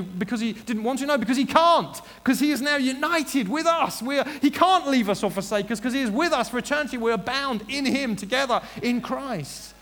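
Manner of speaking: loud, high, impassioned preacher; full-force preaching. Real, not acted. Preacher assuring the congregation they are never left, impassioned.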